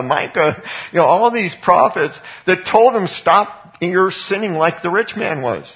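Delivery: high, loud preaching at full force; fiery preaching. Acted. Preacher pressing the congregation, high and loud. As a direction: drive it home to the congregation. Preacher drives the point home.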